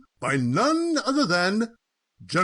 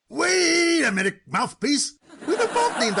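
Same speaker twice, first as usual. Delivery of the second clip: sudden realisation; 8 semitones higher than usual. Acted. Cousin realises the name mix-up.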